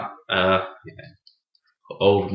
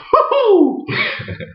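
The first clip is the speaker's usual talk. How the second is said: squealing laugh. Real, not acted. Laughs after own impression.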